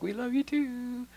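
put-on high, slow childlike sing-song; a hint of children's voices; lightly voiced kids. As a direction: echo it back like a sweet little kid. Kids' voice quoted.

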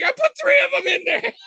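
very high squeal of a reveal; mock toddler triumph; gleeful toddler. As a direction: squeak it like a proud little kid. Toddler confessing prank proudly.

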